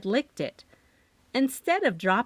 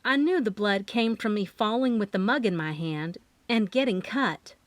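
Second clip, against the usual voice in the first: usual speaking voice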